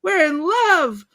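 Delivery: high, slow, loud announcement; imitated lovestruck scream. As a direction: yell it out, stretching "love". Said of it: "he's screaming all about".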